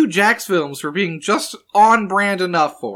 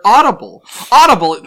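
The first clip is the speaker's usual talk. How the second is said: louder, punchy sponsor-announcer voice; mock ad read; 3 semitones higher, 8 dB louder than usual. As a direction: sell it like a fake radio spot. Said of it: "we are not sponsored by Audible".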